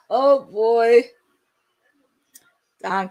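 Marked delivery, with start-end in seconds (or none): 0.1-1.0 a laugh on the words, louder; amused chuckle. Real, not acted. Reacting to the result, laughing it off.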